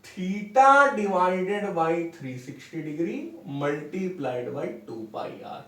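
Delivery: very slow, each word spaced for note-taking; slow dictation. Shown as a demonstration. Teacher dictating a definition to students, very slow and even. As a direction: spell it out slowly so students can write.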